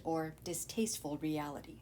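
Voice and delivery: usual speaking voice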